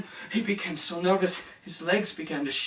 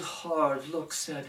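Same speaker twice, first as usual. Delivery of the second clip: ordinary delivery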